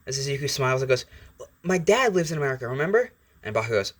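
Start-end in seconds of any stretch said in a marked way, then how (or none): 1.6-3.1 put-on higher, rising happy boy voice; light up with happy pride; extremely happy. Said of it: "Izuku is kind of extremely happy".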